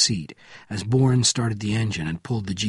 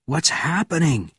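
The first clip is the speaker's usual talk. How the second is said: high, moderately raised shout; shouted question; 6 semitones higher than usual. A narrator's reading. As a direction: call out the question urgently. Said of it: "shouted Benjamin".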